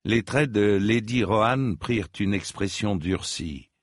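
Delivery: ordinary delivery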